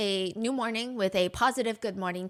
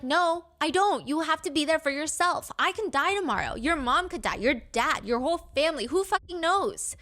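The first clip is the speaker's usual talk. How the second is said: blunt; 6 semitones higher than usual. Retold or imitated. Dom's blunt reply retold.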